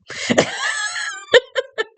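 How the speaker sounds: a sudden squealing burst of laughter; high cry of a laugh; high shrieking laugh. Real, not acted. Loud laugh after quote.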